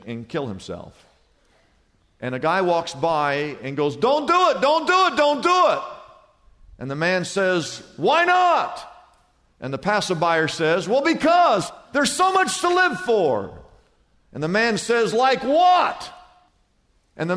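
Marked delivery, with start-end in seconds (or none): 3.9-5.8 high, loud acted plea; urgent pleading. Passerby stops man about to jump. 7.9-8.9 high-pitched challenge thrown back; hammed-up desperate jumper; acted protest. Would-be jumper challenges the passerby. 10.9-13.6 high, acted appeal; hammed-up passerby, voice pitched up; urgent persuasion. Passerby argues with jumper. 15.1-16.1 high, loud retort; hammed-up desperate jumper; acted challenge.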